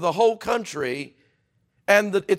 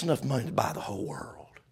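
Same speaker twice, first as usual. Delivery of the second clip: whisper; 5 semitones lower, 6 dB quieter than usual. Acted. Sudden hush in sermon.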